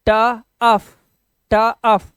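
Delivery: ट and अफ said apart, loud and clear; sounding out parts. Shown as a demonstration. Teacher showing learners sounds to join, sounded out apart. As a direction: break it into ट, pause, अफ. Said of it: "ये आवाज जोड़ दो".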